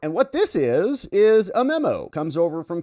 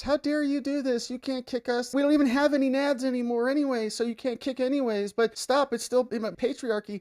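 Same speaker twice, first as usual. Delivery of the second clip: high, soft whimpering mock voice; hammed-up high-pitched sob-story voice; mock whiny complaint; 9 semitones higher, 3 dB quieter than usual. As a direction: play a pitiful whiner for laughs. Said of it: "they start crying from the rooftops".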